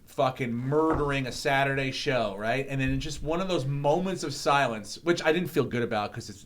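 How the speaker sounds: ordinary talk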